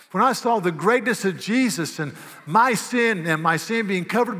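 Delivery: fiery pulpit crescendo; full-force preaching. Acted. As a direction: preach it hard, building all the way. Preaching builds to a climax.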